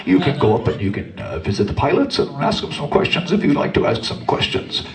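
deadpan steward voice over the intercom; mock flight attendant on the tannoy; airline announcement. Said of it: "they'd say".

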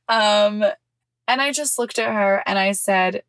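usual speaking voice

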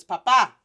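papa with a loud, punched final PA; stress on last syllable. Shown as a demonstration. Teacher showing the accent mark, papa stressed hard on the last syllable. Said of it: "with the accent mark".

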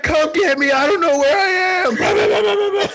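high, loud mock panic, half crying; hammed-up frantic yelling like under gunfire; frantic panicked shout. Fake emergency call continues.

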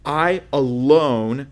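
"alone" loud and drawn out; stress on alone. Shown as a demonstration. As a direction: lean hard on "alone", stretching it slowly. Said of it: "that's important there".

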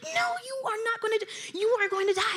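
high-pitched mock pleading; alarmed protest. Stopping him attacking the moose, alarmed protest. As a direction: insist in a high, strained voice.

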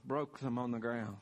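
ordinary delivery

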